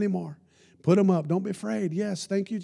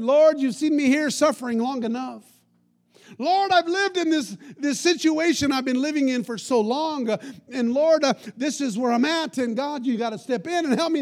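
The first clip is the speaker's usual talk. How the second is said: higher, whining list of grievances to the Lord; mock a self-pitying prayer; complaining prayer; 7 semitones higher than usual. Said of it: "It wasn't".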